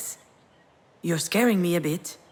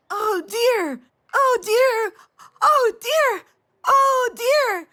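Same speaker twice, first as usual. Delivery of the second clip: put-on high, louder child's cry of Oh, dear; imitating excited toddler; 5 dB louder, much slower than usual. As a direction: squeal it like a thrilled little girl pointing. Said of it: "You pointed in front of you".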